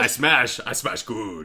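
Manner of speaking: simple-minded monster talk; Hulk voice. Pretend. Echoing the Hulk bit.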